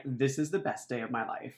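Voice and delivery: quietly thrilled while others panic; deadpan. Acted. Mat delighted amid panic.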